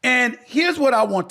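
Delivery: proud Knicks fan finally vindicated; passionate outburst. Real, not acted. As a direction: let years of waiting pour out.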